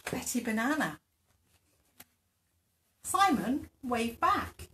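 plain reading voice

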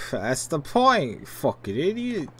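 slow, high-pitched scornful retort; scornful thug. Acted. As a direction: draw it out at an idiot. Thug's retort to the warning.

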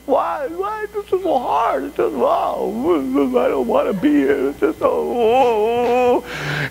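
high, hammed-up tearful whine; fake-cry like a complainer, drawing out the moans; mock whining. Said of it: "I just start complaining".

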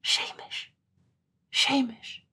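urgent hushed calling of his name; whispered call. A narrator's reading. Katrine whispering for her lover in the dark. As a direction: whisper the name as if he might not hear. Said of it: "and a voice whispered".

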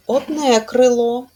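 крило, where the first vowel is reduced and unclear; unstressed vowel demo. Shown as a demonstration. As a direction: show the vague и in крило. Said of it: "Не чітко чуємо". (ненаголошений звук у слові крило)

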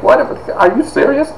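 high-pitched incredulous squeak, half laughing; mock disbelief. Speaker mocking himself for crying over anime, incredulous. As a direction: shoot up high in amused disbelief.

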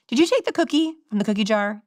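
put-on a parent probing a child, mildly stern; parent questioning. Mother asks her daughter about the cookie, a hint of a stern parent. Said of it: "If I say to my daughter".

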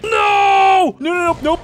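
high, loud cry of no; alarmed yell of no; panicked shout. Real, not acted. Demogorgon about to land on him.

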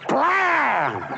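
high, loud mock gunshot; shotgun blast. Joke teller mimics farmer's shotgun blast. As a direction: bark out the shot. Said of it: "and he goes".

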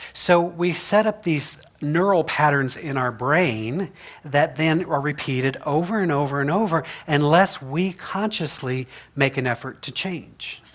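ordinary talk, nothing marked

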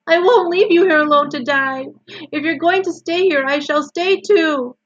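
high, loud, insistent wail; distraught shout. A narrator's reading. Wilbur refuses to leave Charlotte to die, shouting. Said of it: "shouted Wilbur".

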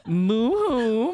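loud, high fake boo-hoo; mock crying. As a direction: wail it in teasing pretend sympathy. Mocking Helen's plea with fake sobbing.

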